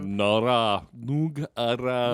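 choppy mock Russian, one word at a time; staccato. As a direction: bite off each fake Russian syllable. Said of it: "Sean Connery having to speak Russian".

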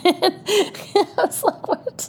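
high squeaky laugh through the words; cracking up, can't believe it; laughing disbelief. Real, not acted. Laughing at the surprise.

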